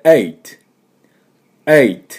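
eight opening on a startled, throaty "eh"; model "eight". Shown as a demonstration. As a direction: start eight like a surprised "eh", end on crisp T. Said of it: "ていう風に出してください". (驚いた「え」で始めるeight)

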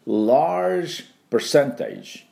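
Large percentage spoken slowly as an English model; careful slow English Large percentage; teacher's slow demo. Shown as a demonstration. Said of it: "何ていうかというと簡単です".

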